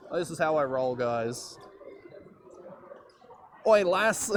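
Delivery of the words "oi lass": playful put-on Aussie "Oi, lass", higher and louder; hammed-up Australian greeting; light Aussie accent, joking; mock Australian. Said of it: "Put on the thick Australian accent".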